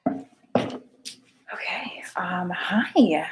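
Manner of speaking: unvoiced hushed whisper while fumbling. Real, not acted. Host whispering to herself before going live. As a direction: breathe it out, barely voiced.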